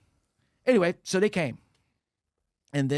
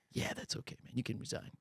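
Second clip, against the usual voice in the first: soft unvoiced reassurance; whispered reply; 4 semitones lower, 13 dB quieter than usual. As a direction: breathe it out gently. Manager's quiet reply.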